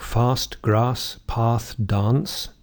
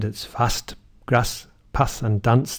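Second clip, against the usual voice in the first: northern English: "fast", "grass", "path", "dance" with a short a; northern English accent; slower than usual. Shown as a demonstration. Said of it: "In the north of England".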